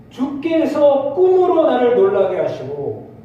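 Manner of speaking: slow, loud, high solemn reading; scripture reading. Acted. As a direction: stretch out the verse solemnly. Reads verse slowly.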